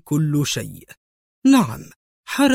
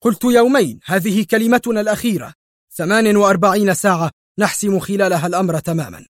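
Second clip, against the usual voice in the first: loud, high and fast ruling; stern, rushed and final; stern cut-off; 6 semitones higher, 3 dB louder, faster than usual. A narrator's reading. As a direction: cut him off hard. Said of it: "قطعه مايكل في صرامة".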